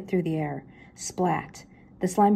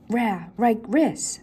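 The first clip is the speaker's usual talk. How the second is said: slow, higher growly dog speech; Scooby dog voice; 4 semitones higher, 3 dB louder, much slower than usual. Acted. Scooby agreeing with Shaggy in dog voice. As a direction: talk like a cartoon dog.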